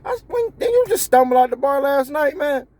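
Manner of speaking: high, scoffing jeer; mock the boss's drunk night; mocking team. Team mocking the boss after a drunken night. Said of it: "They all looking at you like".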